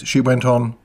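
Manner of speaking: usual speaking voice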